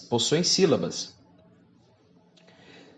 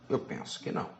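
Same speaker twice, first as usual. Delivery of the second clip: whispered repeat, no voice; the same words said again as a soft whispered echo; 10 dB quieter than usual. Real, not acted. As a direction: breathe it as an aside to yourself. Repeats own answer, then whispers.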